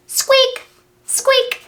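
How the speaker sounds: high squeaky mouse voice; mouse squeaks. Acted. Voicing mice for a child, high squeaks. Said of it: "the mice are saying".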